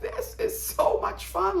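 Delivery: high, slow, half-whispered thrill; giddy delight. Retold or imitated. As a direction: breathe it out like a fan who can't contain herself. Her own reaction to the drama.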